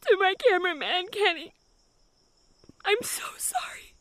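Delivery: high, slow, frightened confession; scared, overdramatic confession. Acted. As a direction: melodramatic terrified farewell, drawn out high. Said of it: "She's so fucking dramatic".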